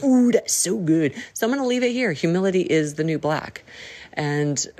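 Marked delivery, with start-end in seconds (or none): 0.0-1.1 impressed gushing ooh; impressed friend. Retold or imitated. Friend reacting to her slogan, impressed. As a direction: gush like you love the line. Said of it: "And they're like".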